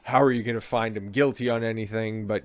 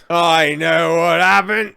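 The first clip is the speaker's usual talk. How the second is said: put-on slow, louder drunk English accent; a bragging drunk English accent; 6 semitones higher, 10 dB louder, slower than usual. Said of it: "grab bag of English accents".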